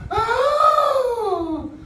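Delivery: very high, loud startled gasp; a shy puppet jumps in fright; scared puppet. Acted. Shy puppet startled by the children, high frightened gasp. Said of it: "'cause it's scared".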